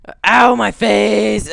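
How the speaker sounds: slow loud cry of pain; pained yell. Pretend. Gamer yelling in mock pain at his fall. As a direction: yell out as the ragdoll hits.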